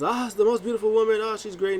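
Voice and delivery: high, fast gushing; going crazy. Retold or imitated. Corey Graves gushing over Carmella, high and fast. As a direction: gush like a smitten commentator. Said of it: "Corey Graves is going crazy".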